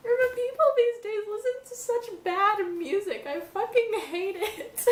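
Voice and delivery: high teary voice laughing through; crying while laughing at bad music; teary laughing rant. Real, not acted.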